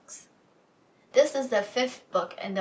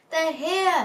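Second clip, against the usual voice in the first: hissed warning; 9 semitones higher, 6 dB louder than usual. Acted. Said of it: "hissed the cat".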